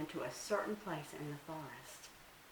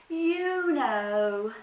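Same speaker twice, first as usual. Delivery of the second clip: much higher, louder innocent little deer; Little Bush Deer; 7 semitones higher, 10 dB louder than usual. Acted. Little deer plays innocent to trick Anansi, high and sweet. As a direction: act innocent, a small high voice. Deer says the words back.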